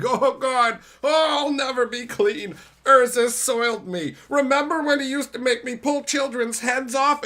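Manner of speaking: high, loud put-on whimper of a broken golem; whiny Karn. Karn whining to the audience about Urza, high mock whine. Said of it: "Karn's all like".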